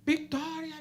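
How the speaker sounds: loud high cry of Victoria; shouted victory. Retold or imitated. Preacher reenacts shouting victory over the man. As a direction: shout it out in triumph. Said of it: "Y grité".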